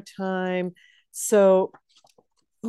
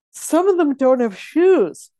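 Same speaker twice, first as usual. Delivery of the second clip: louder, higher exclamation; raised, animated voice; emphatic; 6 semitones higher, 6 dB louder than usual. Real, not acted. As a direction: land it with a mock-outraged punch. Emphatic point about barefoot hobbits.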